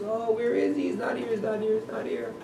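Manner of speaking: high, soft, tearful whimper; imitating a mother starting to cry; mock crying mother. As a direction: whimper it like a mother near tears. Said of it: "And she starts crying".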